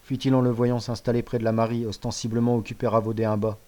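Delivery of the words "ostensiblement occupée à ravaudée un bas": usual speaking voice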